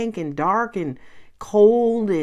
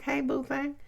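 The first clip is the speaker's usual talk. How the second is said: flirty horse; 6 semitones higher, 9 dB quieter than usual. Retold or imitated. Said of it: "It's like the horse is flirting".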